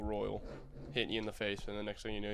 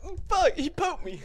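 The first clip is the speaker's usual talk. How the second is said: much higher, hammed-up pained whimper; mock wounded whine; 4 dB louder than usual. Stabbed friend at the bar, mock pained whimper. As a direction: act hurt by a tiny pocketknife. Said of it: "sitting there like".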